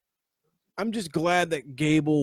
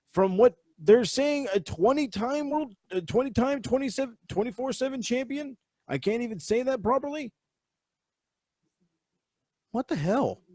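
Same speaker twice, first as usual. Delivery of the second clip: pitched way up in disbelief; stunned disbelief, voice jumping high; incredulous; 9 semitones higher than usual. Real, not acted. Incredulous at twenty-four seven title.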